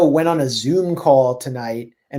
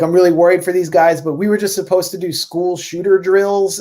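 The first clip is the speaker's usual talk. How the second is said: imitating a worried man, higher and quicker; mock-worried, pitched up a little; retold worry; 5 semitones higher than usual. Mercenary firm's COO fretting over his guys, retold with mock worry. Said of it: "He was worried for Jordan".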